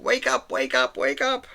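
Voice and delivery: high, pleading little dog voice waking its owner; squeaky pet begging you to get up. Retold or imitated. Dog wakes owner in the night, squeaky and insistent. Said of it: "goes, like".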